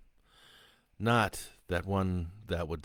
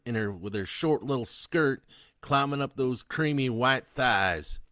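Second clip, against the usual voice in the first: loud, high, sneering convict; defiant killer taunting; 6 semitones higher, 6 dB louder than usual. Acted. Killer Sloan taunting the priest, loud and sneering.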